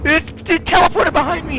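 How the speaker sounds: high shriek then panicked shout; panicked scream. Real, not acted. Monster teleports behind player.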